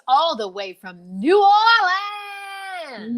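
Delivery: stretched, booming showbiz introduction; announcer shout. Acted. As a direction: belt it like a ring announcer bringing her in. Host announcing guest arrival.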